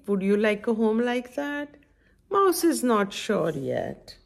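usual speaking voice

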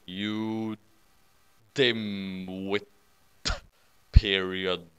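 low, soft, drawn-out put-down; deadpan insult. Acted. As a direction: drop low and space out each word. Insult slowly delivered in reply.

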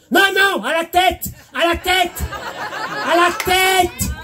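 high, mock-outraged repeats; insisting client. Client correcting the hairdresser, high mock outrage.

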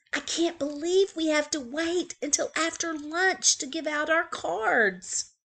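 a touch of a whisper. Acted. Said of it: "Franklin whispered to Bear".

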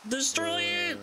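high shouted destroy; high villain cry; villainous command. Acted. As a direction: order it like a mad villain. Commanding the explosion.

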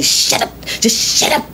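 unvoiced, hissed shut up; mock an aggravated parent hissing; imitating a hissing mother. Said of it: "get in her child's face and say".

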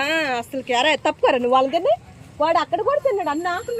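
loud scolding of a greedy dog. Real, not acted. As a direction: tell the dog off loudly. (గట్టిగా మందలిస్తూ)

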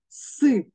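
«С» as a separate hard s sound; ізольований звук С. Shown as a demonstration. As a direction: sound out «С» on its own. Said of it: "позначає різні звуки". (окремий твердий звук «С»)

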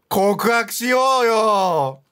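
playful drawn-out cheer; egging on shout. Real, not acted. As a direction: urge her loudly to confess. Egging the character on.

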